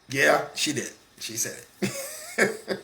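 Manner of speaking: squeaky laugh through the words; giggling it out in real amusement; laughter breaking into the words. Real, not acted. Laughing at the sister's joke.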